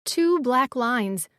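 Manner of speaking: ordinary delivery, nothing marked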